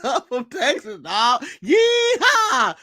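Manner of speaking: mocking a friend's take with a Texas accent; mock cowboy twang. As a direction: play a cartoon cowboy for a laugh. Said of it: "When did she become like Diamond Dog?".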